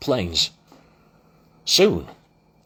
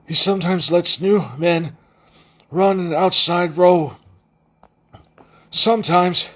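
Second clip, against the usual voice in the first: higher, hollow-sounding stranger; 8 semitones higher, 4 dB louder than usual. Acted. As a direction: give it a hollow, muffled ring. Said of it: "His voice sounded hollow".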